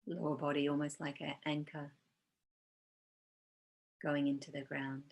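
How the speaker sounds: calm, unhurried meditation guide with long pauses; guided meditation. Real, not acted. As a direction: gently lead them into body awareness, leaving space between phrases.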